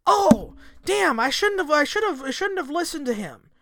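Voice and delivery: high whining regret, lightly hammed; imitating a sorry listener in a high whine; mock whining regret. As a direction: play the arrested listener whining. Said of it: "I'm gonna be like".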